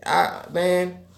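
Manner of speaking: usual speaking voice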